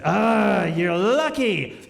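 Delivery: loud, drawn-out pirate growl; hammed-up pirate standing in for South African; South African captain as pirate. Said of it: "I don't do a South African accent".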